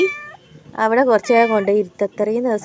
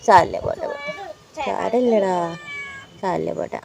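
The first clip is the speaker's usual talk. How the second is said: soothing hush; 3 semitones lower than usual. Real, not acted. Soothing the cat.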